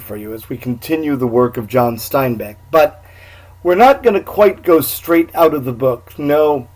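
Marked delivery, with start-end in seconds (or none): none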